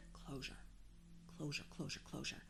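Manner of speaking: muttering. Real, not acted. Mulling the word over.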